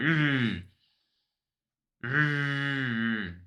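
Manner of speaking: two short, imitated groans of annoyance; mock angry groan. Retelling squeezing the tip, mock annoyed groans. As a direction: replay the disgusted ugh twice. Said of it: "be like".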